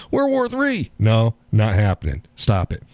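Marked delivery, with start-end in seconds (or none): 0.1-0.9 high, squealed mock alarm; mock panic. Mocking the panic over war.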